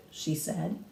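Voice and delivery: ordinary delivery, nothing marked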